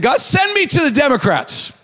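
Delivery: fervent plea. Retold or imitated. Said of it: "And I said".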